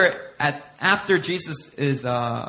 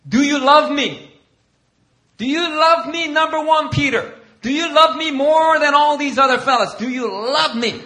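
higher, louder pleading Jesus, pressing Peter; insistent Jesus; 9 semitones higher, 5 dB louder than usual. Retold or imitated. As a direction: keep asking Peter, pushing each time. Voices Jesus questioning Peter.

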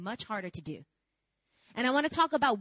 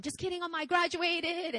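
gleeful confession; 8 semitones higher than usual. Retold or imitated. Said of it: "cried to my mom".

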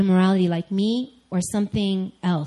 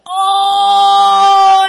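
very loud, high, held "oh" of pain; hammed-up long howl as the cramp returns; acted pain howl; 12 semitones higher, 11 dB louder than usual. Second acted stomach cramp.

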